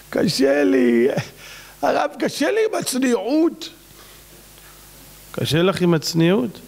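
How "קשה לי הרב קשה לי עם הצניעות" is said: high whiny imitation; hammed-up helpless complaint; mock complaining. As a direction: whine to the rabbi in a put-on voice. Imitates woman complaining to rabbi.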